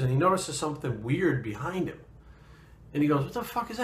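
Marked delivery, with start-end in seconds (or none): 3.2-3.8 high, hushed startled question. Retold or imitated. Rich spots a strange shape in the photo, startled and high. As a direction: pitch it up like a jolt of alarm.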